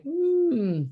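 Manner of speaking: high, drawn-out "hmm"; doubtful hum. Retold or imitated. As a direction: hum high, as if unsure what to do. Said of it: "that I'm like".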